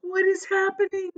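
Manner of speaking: high startled question; jolted awake and confused; boy crying out from his hammock; alarmed cry. A narrator's reading. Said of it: "cried James".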